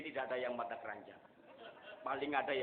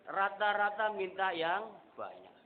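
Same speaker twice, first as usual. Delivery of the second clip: preaching emphasis; 7 semitones higher, 7 dB louder, slower than usual. Real, not acted. Preacher stresses the point.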